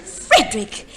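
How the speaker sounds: scandalised wife rounding on her husband; shocked. Acted. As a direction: snap his name in shock.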